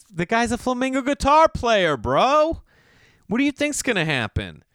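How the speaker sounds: exasperated. Real, not acted. Comic telling audience the obvious, exasperated shout. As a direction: throw up your hands at it.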